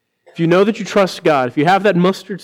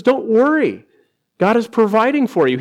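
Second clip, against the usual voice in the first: Jesus reassuring; 6 semitones higher than usual. Retold or imitated. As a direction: tell them not to worry. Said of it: "He says".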